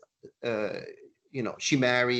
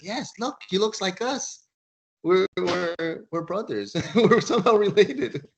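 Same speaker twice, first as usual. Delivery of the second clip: excited realization; 7 semitones higher, 5 dB louder than usual. Retold or imitated. Marines excitedly realize kinship.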